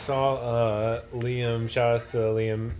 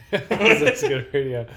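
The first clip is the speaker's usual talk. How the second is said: laughing; 7 dB louder than usual. Real, not acted. Cohost laughs, echoing the line.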